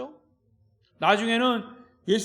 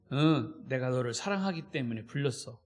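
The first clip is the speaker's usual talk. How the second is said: lower, softer tender reply; loving answer; 8 semitones lower, 5 dB quieter than usual. Shown as a demonstration. Preacher shows a loving parent's answer, soft and tender. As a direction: tell him gently you called out of love. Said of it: "다르잖아요".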